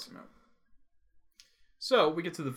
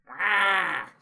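high squeal into laughter; shrieking laugh; 7 semitones higher, 5 dB louder than usual. Real, not acted. Shriek and laugh after quote.

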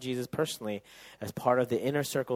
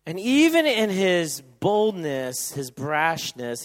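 slow emphasis; 6 semitones higher, 9 dB louder, slower than usual. Acted.

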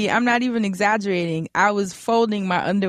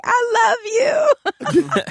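high, loud mock declaration; gushing fan; 13 semitones higher, 4 dB louder than usual. Fan surprises the host folding underwear in laundry room, gushing. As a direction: imitate a fan blurting out devotion.

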